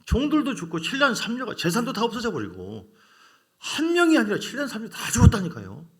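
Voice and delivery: preacher stressing Job's losses, loud and high; emphatic preaching. Real, not acted. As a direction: hammer home how much was lost.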